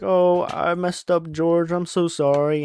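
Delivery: high, slow mock-remorseful voice; Lenny. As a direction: apologize like an overgrown child, lightly hammed. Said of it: "Lenny's like".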